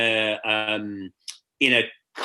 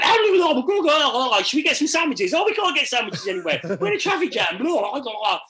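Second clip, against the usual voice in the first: mock Scottish accent; 10 semitones higher than usual. Mimicking Scotty in traffic, mock Scottish accent. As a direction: ham up a Scottish mate's accent. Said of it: "Here's my Scottish accent".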